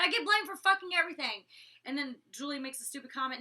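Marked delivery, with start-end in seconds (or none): 0.0-1.5 higher, louder retold outburst; exasperated raised voice, retelling; indignant protest. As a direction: protest loudly at being blamed. Acted protest in retold argument.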